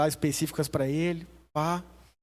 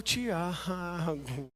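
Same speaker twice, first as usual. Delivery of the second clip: exasperated wife; much slower than usual. Retold or imitated. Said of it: "Ela falou".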